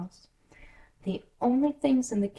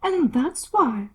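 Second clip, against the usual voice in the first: a hint of a haughty duchess, slow; lightly voiced Duchess; 7 semitones higher, 6 dB louder, slower than usual. Duchess answering Alice, lightly haughty. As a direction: drawl it out a little grandly.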